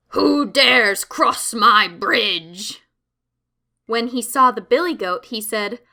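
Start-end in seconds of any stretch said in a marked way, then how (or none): 0.1-2.8 slow, loud, booming troll challenge. A narrator's reading. Troll bellowing at the little goat on his bridge, slow and loud. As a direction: roar it out slowly like a troll guarding his bridge. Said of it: "The troll leaped out ... and said".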